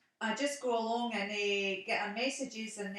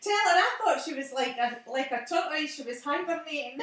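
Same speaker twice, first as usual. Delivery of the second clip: teasing; 4 semitones higher, 7 dB louder than usual. Real, not acted. Teasing message for Hazel.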